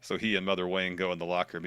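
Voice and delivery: normal talking voice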